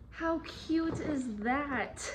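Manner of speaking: high, slow, delighted exclamation; drawn-out cooing excitement; gushing. Real, not acted. Gushing over the gift.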